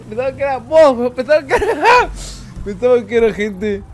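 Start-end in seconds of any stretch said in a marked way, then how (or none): 0.1-1.7 laughing. Real, not acted. Laughing at killing his friend. 1.7-2.6 high laugh. Real, not acted. Laughs after mistaken kill.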